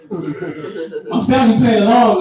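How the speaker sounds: ordinary talk, nothing marked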